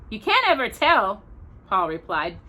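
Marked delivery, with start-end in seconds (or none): 0.1-1.2 higher, firm caution; cautious warning. A narrator's reading. Pa warns Mister Scott, higher and firm. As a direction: warn him gently but firmly.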